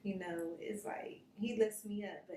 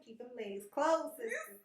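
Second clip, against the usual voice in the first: wag a finger at the sisters, hammed up; playful sermon-style warning; mock preachy warning; 8 semitones higher, 7 dB louder than usual. Mock warning to young women.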